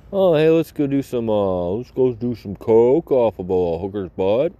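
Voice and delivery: hammed-up excited party voice; loud, giddy, mocking a rich partier; mock party bro.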